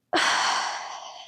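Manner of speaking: breathy release sigh; long sigh. Shown as a demonstration. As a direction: let it all out in one long sigh. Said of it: "sigh and let the breath".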